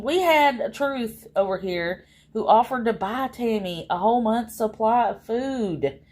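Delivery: ordinary delivery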